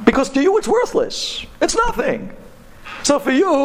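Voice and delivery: high-pitched, emphatic dismissal; dismissive persuasion. Retold or imitated. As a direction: argue it away with pitch pushed up. Scholar goads driver to sell.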